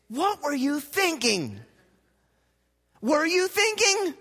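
high, loud incredulous scold; hammed-up exasperated scolding voice; mock scolding. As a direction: scold like a fed-up parent: what were you thinking. Preacher mocks a scolding voice.

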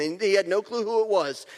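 ordinary talk

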